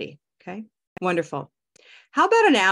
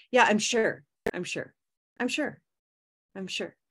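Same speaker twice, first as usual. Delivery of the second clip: quick, clipped "I'm sure" with a short "sure"; fast way; 2 semitones higher than usual. Shown as a demonstration. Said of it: "sort of a fast way".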